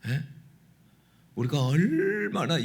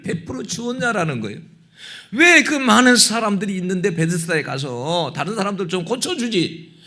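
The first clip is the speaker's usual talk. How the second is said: loud, higher, driving sermon climax; fervent preaching; 7 semitones higher, 9 dB louder than usual. Acted. Pastor pressing the message on the congregation, loud.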